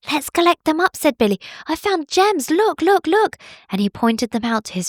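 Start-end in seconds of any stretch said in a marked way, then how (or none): 0.1-1.0 high, eager little bunny; a small excited bunny rallying friends; Billy bunny voice. Acted. Little bunny calling his friends, high and eager. 1.7-3.4 high, bouncy little bunny thrilled at his find; excited bunny. Acted. Bunny showing friends his gems, high and excited.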